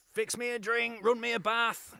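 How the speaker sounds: lightly voiced Clough giving orders; mock Clough orders. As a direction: bark the errands like the gaffer.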